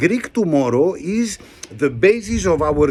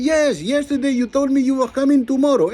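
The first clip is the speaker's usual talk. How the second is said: mock exasperated buyer; 9 semitones higher, faster than usual. Buyer argues with worker.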